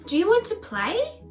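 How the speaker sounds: put-on high, quick, eager kid voice; eager boy voice. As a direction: invite the dog to play. Jack inviting dog to play.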